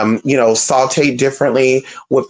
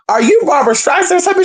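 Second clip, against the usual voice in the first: higher and fast, a sassy dig at a diva; mocking diva retort; faster than usual. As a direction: throw the diva question back, mocking and quick. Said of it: "she was giving [name]".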